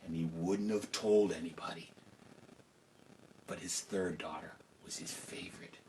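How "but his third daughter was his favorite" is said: whispered secret. A narrator's reading. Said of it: "confiding aside to listeners".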